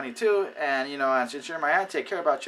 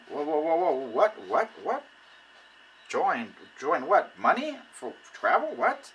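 mock taken-aback "whoa, what?"; startled auntie; 2 semitones higher, slower than usual. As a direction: play the relative thrown off by the pitch. Auntie startled by pushy pitch.

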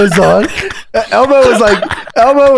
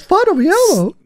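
high, softer puppet voice; Elmo; 10 semitones higher, 3 dB quieter than usual. Retold or imitated. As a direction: mimic a high-pitched Elmo. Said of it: "And he goes".

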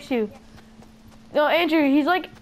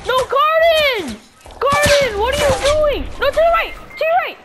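high, loud and frantic; panicked callout; 9 semitones higher, 4 dB louder than usual. Real, not acted. Warning a teammate about an enemy, frantic and high. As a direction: yell the warning before it's too late.

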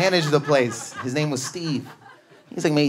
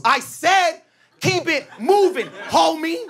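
menacing dude; slower than usual. Retold or imitated. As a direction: lean on every word, menacing. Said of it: "Dude goes".

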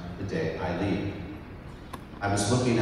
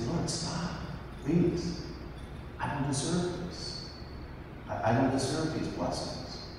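higher, hushed plea to the Lord; whispered prayer; 4 semitones higher, 10 dB quieter than usual. Retold or imitated. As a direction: whisper it like an overwhelmed, humble prayer. Humble prayer to God.

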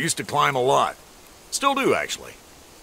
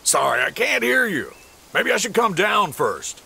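raised, higher shout; shouting over machinery; 7 semitones higher than usual. Acted. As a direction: call out over the din. Shouting down from the tree.

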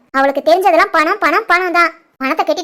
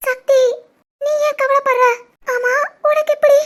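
tearful, higher pitched voice; crying; 5 semitones higher than usual. Acted. Wife crying to her husband on the phone. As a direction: talk through the tears.

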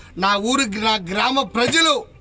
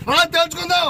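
higher shouted dare; angry dare; 5 semitones higher than usual. Real, not acted. Daring rival to come settle it. (కోపంగా సవాలు)

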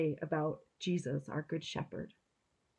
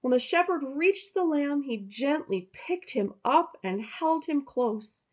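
higher, storybook narration; reading to children; 6 semitones higher than usual. A narrator's reading. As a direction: tell it like a bedtime story to kids.

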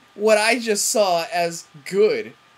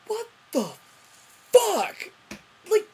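outraged disbelief; 11 semitones higher, slower than usual. Real, not acted. Angry disbelief at plot.

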